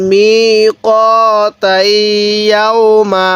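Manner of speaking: very slow, every syllable stretched; drawn-out reading. Shown as a demonstration. Teacher drawing out the word for students, very slowly. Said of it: "এরকমভাবে শিখব".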